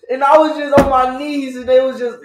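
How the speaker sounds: loud, high, dramatic accusation; mock victim testimony. Friend playing victim while accusing host of dragging, mock outraged.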